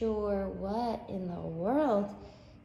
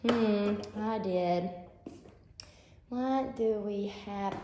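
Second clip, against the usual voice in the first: ordinary talk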